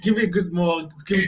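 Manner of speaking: normal talking voice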